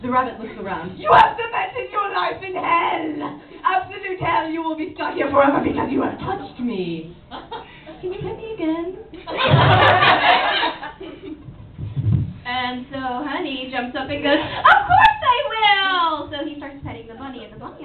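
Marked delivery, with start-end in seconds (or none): 1.0-7.2 higher, drawn-out pronouncement of doom; cursing rabbit. Acted. Rabbit curses everyone. 8.0-9.0 high, soft little plea; meek pleading rabbit. Acted. Rabbit suddenly asks for petting. 14.4-16.3 high, long-drawn happy cry; delighted cutesy character. Acted. Honey jumps up delighted.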